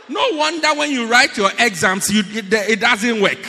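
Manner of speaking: loud, indignant pulpit rebuke; booming sermon reproach; full-force preaching. Real, not acted. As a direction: preach it at full volume, scolding the congregation.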